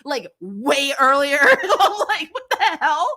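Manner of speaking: high, slow, laughing disbelief; mock exasperation at Mel, high and laughing. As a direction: laugh through an incredulous complaint.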